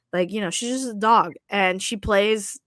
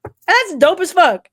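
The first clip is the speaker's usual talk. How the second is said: higher and louder, hyped; 9 semitones higher, 9 dB louder than usual. Real, not acted. Host hyping the players' bold move, excited and loud. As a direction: sell it as dope.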